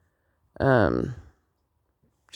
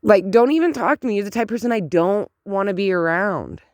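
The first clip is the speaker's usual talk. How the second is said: high, indignant outburst that settles slightly; outraged disbelief; 5 semitones higher than usual. Acted. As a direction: fire back at a bragging bystander, appalled. Outraged reply to bragging neighbor.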